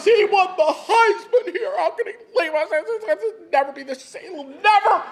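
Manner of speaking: laughing mock-cry of an eleven-year-old; mock wailing. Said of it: "He goes".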